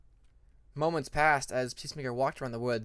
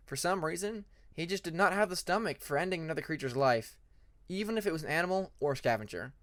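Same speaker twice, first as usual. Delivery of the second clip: plain reading voice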